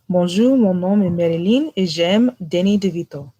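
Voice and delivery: quick French line with an American accent; speaking French. Shown as a demonstration. Friend asked for French, spoken in French. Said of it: "I need you to say this in French".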